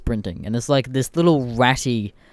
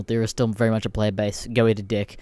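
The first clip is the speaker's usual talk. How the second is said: normal talking voice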